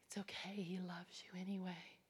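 soft reassuring whisper; tender whisper. Retold or imitated. Preacher reassuring the weeping inmate in a tender whisper. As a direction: comfort her gently, barely voiced.

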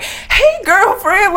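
very high, loud put-on "Hey, girlfriend"; mock gushing greeting. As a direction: parody the fake-friendly greeting. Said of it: "and be like".

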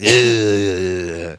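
low drawn-out mock grunt; a long put-on growl. Podcaster mimics [name], low wordless growl. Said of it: "who's like".

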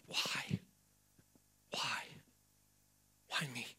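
soft, near-whispered questioning of God; voiceless, wounded plea; hushed pleading. Acted. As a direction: ask it quietly, with long pauses. Acts out believers questioning God.

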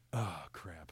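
low, soft retold realization; deflated. Husband backs down after wife's warning, deflated. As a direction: let the fight drain out, dropping low.

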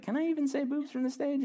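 checking with the wife, mock alarmed; incredulous question.

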